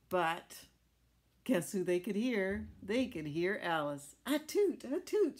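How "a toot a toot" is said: high, loud sneezing toot; squeaky tugboat sneeze. Acted. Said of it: "she sneezed".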